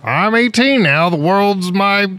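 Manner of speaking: high, slow grandiose declaration. Pretend. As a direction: proclaim it like a grand hero. Imitating grand eighteen-year-old.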